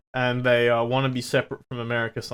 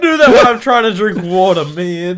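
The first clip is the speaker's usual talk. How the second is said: laughing; 8 semitones higher, 11 dB louder, much slower than usual. Real, not acted. Laughing complaint.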